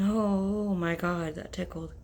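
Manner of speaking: ordinary talk, nothing marked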